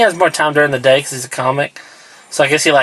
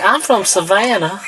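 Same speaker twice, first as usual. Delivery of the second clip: put-on Georgia accent; 4 semitones higher than usual. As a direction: put on a comic Savannah accent. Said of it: "like, a Georgia accent".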